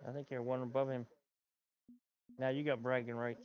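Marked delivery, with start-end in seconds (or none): none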